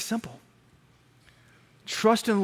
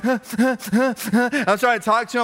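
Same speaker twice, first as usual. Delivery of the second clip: imitated sobbing gasps, slow broken words; breathless crying; 4 semitones higher, much slower than usual. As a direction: try talking through mock sobs. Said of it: "talk in that can't breathe, crying state".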